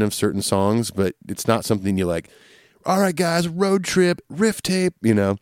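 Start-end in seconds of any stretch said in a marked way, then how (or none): 2.8-4.9 mock excited fan. Mock fan putting on riff tapes.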